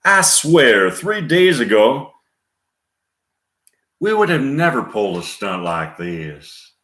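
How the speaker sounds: lilting, emphatic Southern voice; Gina Davis Southern accent. Acted. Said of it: "Gina Davis in Southern accent".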